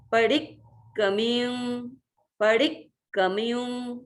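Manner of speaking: पडिक्कमियुं slow, with the first क joined to डि; पडिक्कमियुं with a half क on डि; doubled k. Shown as a demonstration. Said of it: "एक क को डी के साथ अपन मिला के".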